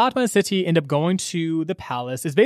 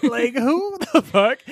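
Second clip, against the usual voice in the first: incredulous bro; 6 semitones higher, slower than usual. Retold or imitated. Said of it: "nine lords are like".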